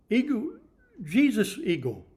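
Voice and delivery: usual speaking voice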